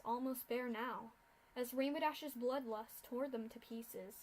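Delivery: usual speaking voice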